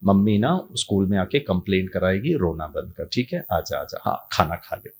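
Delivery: low, soft coaxing; soothing mother. Retold or imitated. Mother calming her crying son, low and soft. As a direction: hush the child gently.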